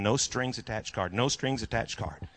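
low, quiet thought to himself; private thought. Retold or imitated. Pastor privately remembering his card, quiet inner voice. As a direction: say it softly under your breath. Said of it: "And I'm thinking".

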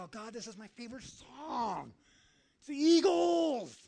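high whine building to a loud drawn-out shout; pleading fan outburst. Acted. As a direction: protest like a fan, exploding on "Eagles". Acted fan protest, building to shout.

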